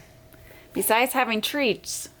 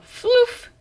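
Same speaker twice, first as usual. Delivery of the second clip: high pillow puff; 14 semitones higher, 4 dB louder than usual. A narrator's reading.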